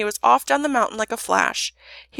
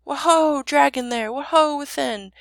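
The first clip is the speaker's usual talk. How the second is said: raised, high calling voice; shouted hail; 5 semitones higher, 4 dB louder, slower than usual. A narrator's reading. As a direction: call loudly into a cave. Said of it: "the prince shouted".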